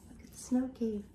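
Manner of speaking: a breathy storytime whisper. Real, not acted. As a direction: whisper it like a secret to the class. Whispering to the children.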